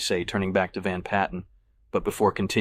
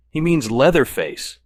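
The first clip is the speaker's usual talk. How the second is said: slow, higher, louder clenched-teeth correction; irritated man corrects a friend through clenched teeth; 7 semitones higher, 7 dB louder, slower than usual. A narrator's reading. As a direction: force it out through gritted teeth. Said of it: "teeth tightly clenched".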